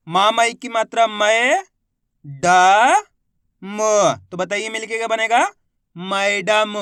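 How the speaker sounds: "मै", "ड", "म" held apart, then "मैडम"; letter-by-letter sounding out. Shown as a demonstration. Teacher quizzing learners on blending, syllable by syllable. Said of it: "एक अक्षर का उच्चारण करके इनको पढ़".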